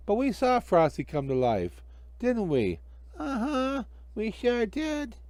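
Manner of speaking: put-on high, slow kid voices; children. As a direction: kids backing each other up. Children agree.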